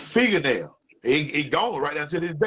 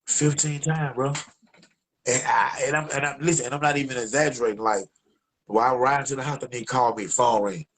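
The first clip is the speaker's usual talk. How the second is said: ordinary delivery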